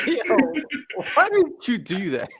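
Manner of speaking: high-pitched, amused disbelief; real giggly outcry at a friend; laughing protest. Real, not acted. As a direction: squeal the question through laughter.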